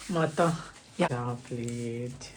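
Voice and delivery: soft, low, drawn-out plea; pleading. Real, not acted. Begging partner not to leave for work, softly pleading. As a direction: stretch out a quiet please.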